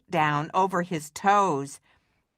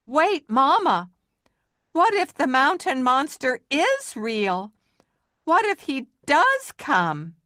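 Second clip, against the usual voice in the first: higher, louder worried call; 7 semitones higher, 3 dB louder than usual. Acted. Maxwell calling to his departing mother, worried. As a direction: call after her before she leaves. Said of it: "called out".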